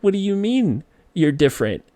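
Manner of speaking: put-on high-pitched, puzzled kid's protest; incredulous little-kid question; bewildered child. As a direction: imitate a child who can't understand. Child bewildered by stepdad's explanation.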